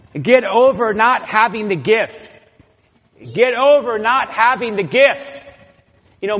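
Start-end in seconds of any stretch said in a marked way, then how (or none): 0.1-2.3 preaching emphasis. Real, not acted. Preacher repeats point louder. 3.2-5.4 stronger preaching emphasis. Real, not acted. Repeated even more forcefully.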